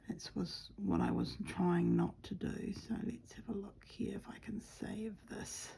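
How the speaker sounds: quiet whispered narration while stitching; soft whisper. Acted. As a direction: whisper close to the mic as you work.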